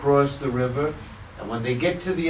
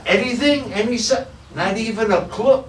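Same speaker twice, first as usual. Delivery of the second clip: higher and louder, a mock anxious question; anxious searcher; 8 semitones higher, 4 dB louder than usual. Monks asking each other after the search, mock anxious. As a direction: ask eagerly, hoping for news.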